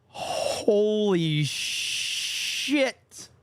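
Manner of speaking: ordinary delivery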